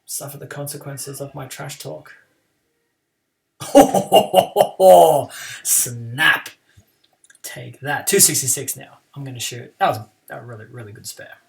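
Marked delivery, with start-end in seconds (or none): 3.6-5.6 real laugh. Real, not acted. Laughs at own bad shot.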